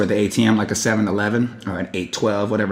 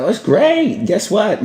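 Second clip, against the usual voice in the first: bright, much higher pitched eager answer; excited reply; 10 semitones higher, slower than usual. Retold or imitated. Telling the boss the good news, excited. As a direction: jump in high with the good news.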